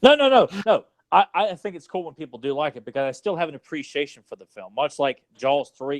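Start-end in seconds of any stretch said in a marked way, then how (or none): 0.0-0.8 quick high burst of no's, louder; emphatic no. Real, not acted. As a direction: protest fast and high. Quick protest to friend.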